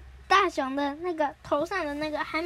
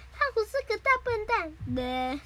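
high, sing-out cartoon jeer; mock taunt; 7 semitones higher than usual. Suneo blurts an insult at Gian, taunting. Repeated taunt under remote control.

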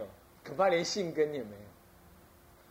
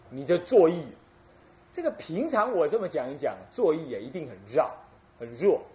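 ordinary talk

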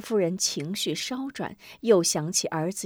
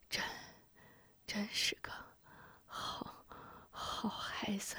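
barely voiced dying whisper, halting; weak whisper; 17 dB quieter than usual. A narrator's reading. Poisoned hero whispering to the boy. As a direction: gasp the words out, fading.

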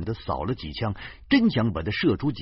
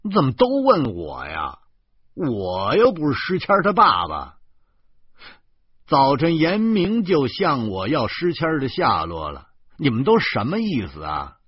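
higher, louder indignant complaint; disgruntled; 5 semitones higher, 5 dB louder than usual. A narrator's reading. Husband to wife on the phone, disgruntled. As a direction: protest in exasperation at being asked. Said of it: "我不满地说".

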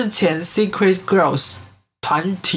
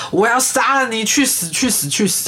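retold hysterical death threat, higher pitch, restrained volume; mock frenzied shouting; 6 semitones higher than usual. Podcaster retelling an attacker's cries during the stabbing, mock frenzy. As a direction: act out a mild frenzied yell, repeating insistently. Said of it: "一边不断喊着".

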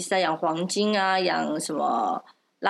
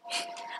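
quiet snicker; 13 dB quieter than usual. Real, not acted.

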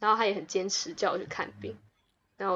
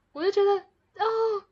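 touched; 10 semitones higher, 4 dB louder than usual. Real, not acted. Telling her friend how moved she was by her dad, gushing. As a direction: gush over how sweet dad was.

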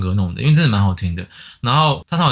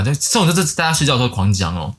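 ordinary delivery

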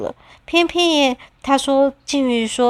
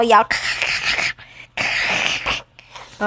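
biting crunches; 4 dB quieter than usual. Acted. 虎鲸咬桶子的声音.

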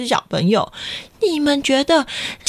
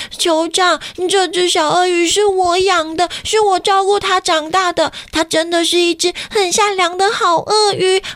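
put-on higher, earnest child pleading; 5 semitones higher than usual. A narrator's reading. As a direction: beg the chief like a child. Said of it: "卡卡伊跟酋长说".

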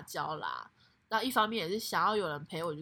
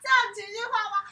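high, squealing laughing question; real laughter, much higher; laughing protest; 14 semitones higher, 7 dB louder than usual. Real, not acted.

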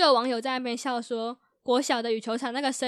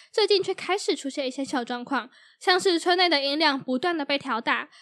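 louder news anchor delivery; news read; 2 semitones higher, 7 dB louder than usual. Acted. Reads a news item aloud. (新闻主播播报腔)

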